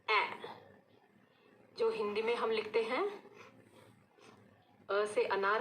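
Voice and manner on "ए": ए as a short open eh sound; phonics sound a. Shown as a demonstration. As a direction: say ए with the mouth a little open, like English a. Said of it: "हम उसको बोलेंगे". (Phonic sound ए, मुंह थोड़ा खोलकर)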